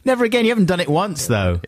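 high, incredulous retort; incredulous challenge. Real, not acted. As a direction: pounce on the claim in a high pitch. Challenges co-host's never-again list.

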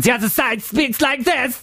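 high, hammed-up German villain voice; German baddie accent. Shown as a demonstration. Co-host contrasts the two warring sides, mock German baddie accent. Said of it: "Like baddies, you mean?".

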